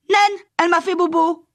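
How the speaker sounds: put-on very high-pitched toddler complaint; put-on child voice, wailing; hurt little child. A narrator's reading. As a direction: whine it like a hurt small child. Hurt small child complains to Madeleine.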